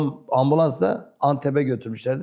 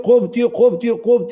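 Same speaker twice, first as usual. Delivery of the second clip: higher, louder angry yelling; angry shout; 6 semitones higher, 5 dB louder, slower than usual. Retold or imitated. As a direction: yell it as the angry boss. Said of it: "bu bana kızıyor".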